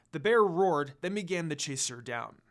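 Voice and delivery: plain reading voice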